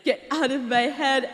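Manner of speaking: normal talking voice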